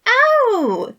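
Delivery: very high, loud oh; the penny drops; sudden realization. A narrator's reading. Remus realizing what they think, high loud oh. Light bulb moment, bursting out laughing.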